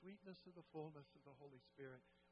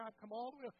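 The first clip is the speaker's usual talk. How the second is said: pleading protest; 6 semitones higher, 6 dB louder, faster than usual. Retold or imitated. Sam pleading with Booth.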